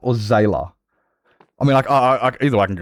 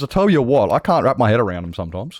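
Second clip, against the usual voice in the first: normal talking voice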